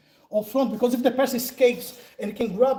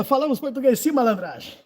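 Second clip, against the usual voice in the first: ordinary talk, nothing marked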